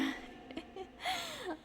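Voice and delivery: short high giggle; high squeaky laugh. Real, not acted. Laughs after quote.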